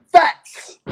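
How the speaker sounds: shouted out high and loud; punched word. Real, not acted. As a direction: slam the word facts. Emphatic build-up to key word.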